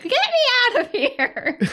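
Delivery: high, drawn-out cat complaint; mock yell. Retelling a cat's tantrum to the cohost, mock yell. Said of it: "it's yelling at her".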